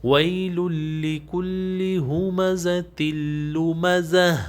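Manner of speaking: slow chanted tajweed; Quran recitation. Shown as a demonstration. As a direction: recite with care. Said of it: "یہ آپ نے لازمی سمجھنا ہے". (تجوید کے ساتھ تلاوت)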